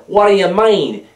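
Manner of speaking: high, loud mock American accent. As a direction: put on a brash American accent. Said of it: "in my American accent".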